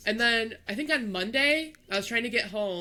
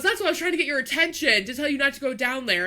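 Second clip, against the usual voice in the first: worker explaining; 6 semitones higher, 5 dB louder, faster than usual. Retold or imitated. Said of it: "And he goes".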